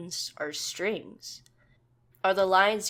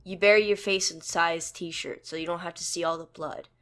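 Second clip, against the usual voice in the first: usual speaking voice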